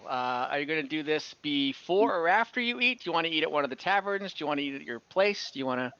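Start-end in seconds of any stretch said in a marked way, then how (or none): none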